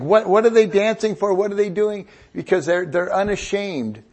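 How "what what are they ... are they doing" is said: baffled parent. Retold or imitated. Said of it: "and you think".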